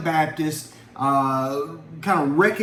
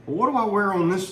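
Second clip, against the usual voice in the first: usual speaking voice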